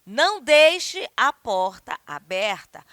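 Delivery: loud, slow, emphatic order; firm, deliberate command; imperative intonation. Shown as a demonstration. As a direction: lay down the order word by word. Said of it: "A entonação, você percebeu".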